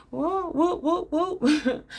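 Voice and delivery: high, laughing whoop whoop chant; excited whooping. Retold or imitated. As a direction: cheer it like a hype chant. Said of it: "that's what I was like".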